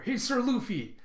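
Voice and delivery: high-pitched call across the water; calling over. Retold or imitated. Kinemon calls over to Luffy from submarine, hailing shout. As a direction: hail someone on another vessel. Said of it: "as Kinemon calls over to him".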